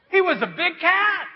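put-on high, loud laughing child. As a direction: giggle through the excuse. Child adds excuse, laughing.

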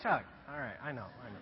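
low, soft, chuckling aside; quiet hushing. Retold or imitated. Preacher settles his embarrassing cheering friend, quiet and low. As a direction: wave off the praise under your voice.

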